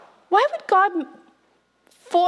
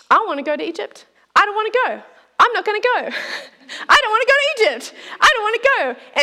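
whiny Moses; 6 semitones higher, much faster than usual. Retold or imitated. Moses whining against God's call.

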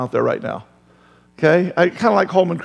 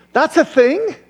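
high, amazed question; preacher voicing a shocked disciple; mock disbelief; 11 semitones higher, 3 dB louder than usual. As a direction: play it stunned. Imagined listener's reaction.

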